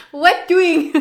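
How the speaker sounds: high, loud imitation of dad; imitating dad. Daughter quotes her dad's catchphrase, loud dad imitation. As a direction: call it out high, like Dad checking in. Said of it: "what our dad would always say".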